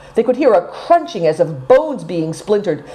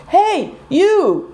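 much higher shout after a fleeing man; calling after him; 12 semitones higher than usual. A narrator's reading. Seven Stars shouts after the fleeing lama, high call. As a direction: call out to stop the rider. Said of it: "cried Seven Stars".

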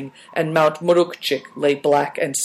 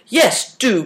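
eager cry; 8 semitones higher than usual. A narrator's reading. Hungry soldier eagerly answering the boy's dumpling offer. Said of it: "cried Good Fortune".

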